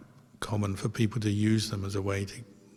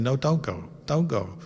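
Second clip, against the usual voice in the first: retold plea, slightly raised in pitch; persistent urging; 4 semitones higher than usual. Monk keeps telling the lady to stay, mildly persistent. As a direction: insist gently, lifting the voice a little. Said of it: "he's kept being persistent".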